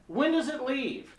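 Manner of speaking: high-pitched clueless tourist voice; dumb tourist. Acted. Tourist asking train worker about schedule, high clueless voice. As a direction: ask it high and naive. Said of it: "my dumb tourist".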